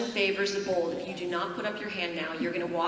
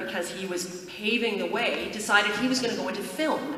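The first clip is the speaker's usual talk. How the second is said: normal talking voice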